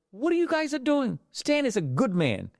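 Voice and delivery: high, incredulous demand; indignant outburst. Retold or imitated. Greg to the security guards, high indignant outburst. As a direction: cut in high and outraged.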